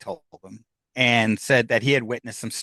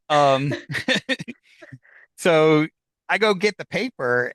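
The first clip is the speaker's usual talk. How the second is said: normal talking voice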